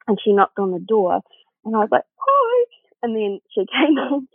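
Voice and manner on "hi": high-pitched mock-weepy greeting; imitated tearful voice. Caught crying by her flatmate, mock-tearful. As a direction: squeak it out as if caught wailing. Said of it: "really crying".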